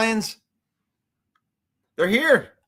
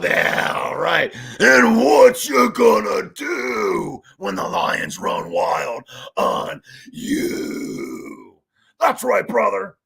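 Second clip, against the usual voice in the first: wrestler promo; 4 dB louder, much slower than usual. Pretend. Hulk Hogan catchphrase impression.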